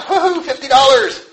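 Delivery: high-pitched gleeful cheer, hammed up; mock excited whoop. Preacher imagines finding money in the street, mock excitement. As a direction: whoop as if thrilled by a windfall.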